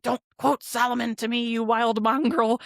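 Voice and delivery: a touch of Nicodemus scolding; retelling Nicodemus rebuking John, mildly indignant; mock indignant. Nicodemus snapping back at John.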